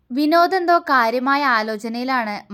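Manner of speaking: plain reading voice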